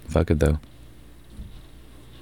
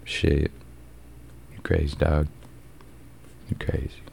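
quieter and quieter, ending whispered; fading to whisper; 2 semitones lower, 11 dB quieter than usual. Retold or imitated. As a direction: trail off into a whisper. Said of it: "He's like".

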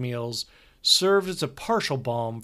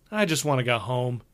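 plain reading voice

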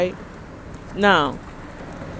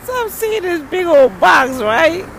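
excited reveal; 8 semitones higher, 4 dB louder than usual. Retold or imitated.

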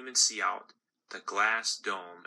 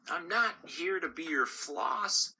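indignant gumball; 8 semitones higher than usual. Acted. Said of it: "he would say".

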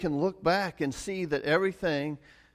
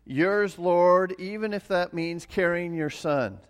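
fervent prayer; 4 semitones higher, 4 dB louder than usual. Retold or imitated. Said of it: "In other words".

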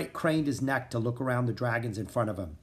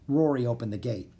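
ordinary delivery, nothing marked

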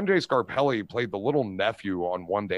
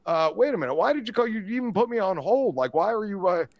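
confused caller; 9 semitones higher than usual. Retold or imitated. Confused man on the prank call.

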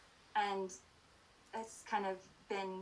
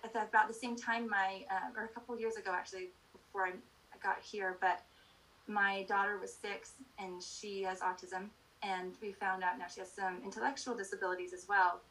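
usual speaking voice

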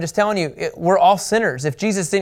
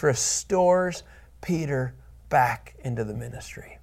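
whisper; 2 semitones lower, 6 dB quieter, slower than usual. Real, not acted. Preacher hushes the key point.

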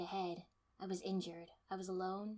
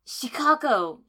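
loud, higher, desperate cry; desperate call; 6 semitones higher, 17 dB louder, slower than usual. A narrator's reading. Shikamaru calling after Shikako, loud and desperate. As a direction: reach for her with the call. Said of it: "Shikamaru called desperately".

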